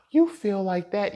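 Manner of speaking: high, loud, drawn-out gasped disbelief; mock shock. Woman reacting to his outburst, mock shocked. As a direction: imitate a scandalized partner with a big gasp. Acting out an offended partner.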